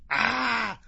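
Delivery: high, loud chimp squeal; monkey shriek. Pretend. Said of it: "I could at least be Chim Chim".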